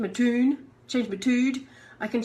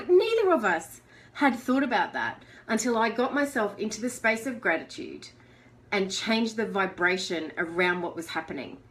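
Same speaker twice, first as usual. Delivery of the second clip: usual speaking voice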